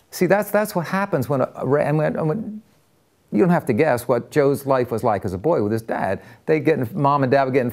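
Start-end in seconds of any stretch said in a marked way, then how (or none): none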